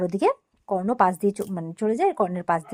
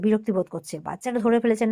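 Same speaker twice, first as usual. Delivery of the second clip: normal talking voice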